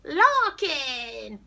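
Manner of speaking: loud, high cartoon holler; over-the-top shouted hello; clownish cartoon character. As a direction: greet them like a human cartoon. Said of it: "he's like a clown, like a cartoon".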